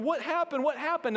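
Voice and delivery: high-pitched, acted distraught questioning; imitated tearful parents. Parents rushing to their fallen daughter, mock tearful. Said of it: "emotional, just in tears, going".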